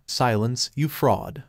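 ordinary delivery, nothing marked

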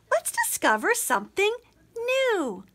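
excited shout. Acted. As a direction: call it out triumphantly. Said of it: "Princess Pippa shouted".

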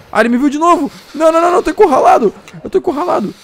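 loud, very high terrified cry; panicked shout. Real, not acted. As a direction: scream it, cornered and scared. Wolf sees him again, cornered.

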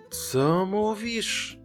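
high, slow, drawn-out question; sly fox asking slowly; feigning deafness. Acted. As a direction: stretch it out as if you can't hear. Fox feigning poor hearing.

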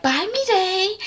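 very high, cooing farewell; flirty singsong goodbye. Retold or imitated. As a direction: coo after a crush, hammed-up. Hadisa flirtily calls after crush.

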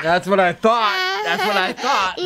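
high squeaky laugh-talk; words breaking into laughter. Real, not acted. Laughing while play-fighting.

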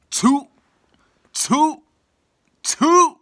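very high, loud hoot; calling out. Real, not acted. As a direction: holler it high to get their attention. Calling out to family members.

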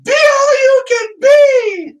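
high, loud, slow cheer-shout across the field; mom yelling. Retold or imitated. Mom yells at her son across practice, loud drawn-out shout. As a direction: belt it like a sideline mom. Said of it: "She yells his name, and she goes".

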